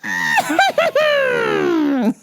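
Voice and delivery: long high shrieking cackle; manic cackle. Retold or imitated. She comes in the store, manic high cackle. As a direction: mimic a crazed, shrieking laugh. Imitates the woman's outburst.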